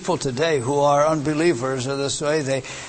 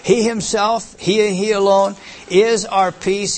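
raised pitch, forceful stress on "himself" and "alone"; emphatic; 3 semitones higher, 4 dB louder than usual. Shown as a demonstration. Preacher driving home the intensive pronoun, emphatic. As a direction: hammer "He himself", then stretch "is our peace". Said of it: "this whole thing is so emphatic".

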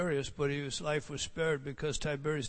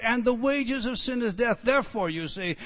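usual speaking voice

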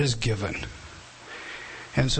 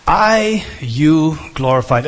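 "you glorified" with YOU hit loud and drawn out, slow; stress on YOU; 7 dB louder, slower than usual. Shown as a demonstration. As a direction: lean hard on "you" and slow down. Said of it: "notice the emphasis".

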